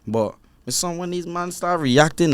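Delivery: ordinary delivery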